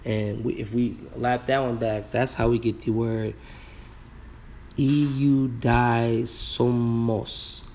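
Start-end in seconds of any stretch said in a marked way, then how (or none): 4.8-7.6 very slow, syllable by syllable; sounding out slowly. Shown as a demonstration. Teacher gives the class the Greek word, sounded out slowly. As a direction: stretch each syllable of the foreign word.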